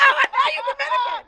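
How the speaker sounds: high, fast, laughing jab; laughing mock accusation. Buyer mocking his connect, high fast laughing jab. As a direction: crack up while accusing. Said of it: "You're like".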